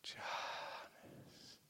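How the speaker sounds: breathy hushed whisper; whispered word. Shown as a demonstration. Naming the fruit of meditation in a whisper. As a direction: say it like a sacred secret. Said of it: "whispered stage name".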